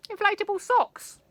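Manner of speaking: "Inflatable" thrown up high and loud; delighted high exclaim. A narrator's reading. Narrator relishing the silliest invention, high and loud. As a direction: squeal "Inflatable socks" with glee.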